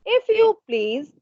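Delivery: put-on high, slow little boy's voice; little prince asking politely in a high voice; odd little child voice. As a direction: ask like a small strange child, drawn out. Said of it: "It, this voice said".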